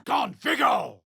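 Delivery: battle cry of a spell; shouted spell. Acted. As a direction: shout the incantation. Spell cast in combat.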